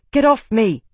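high, loud demand. A narrator's reading. Isana ordering Fade off her, loud. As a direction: push him off with your voice.

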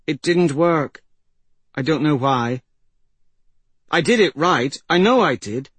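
high, loud, agitated self-defence; upset young girl. Acted.

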